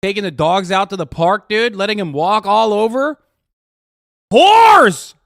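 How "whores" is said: mock outraged shout.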